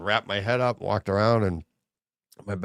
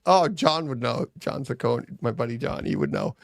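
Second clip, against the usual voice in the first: ordinary delivery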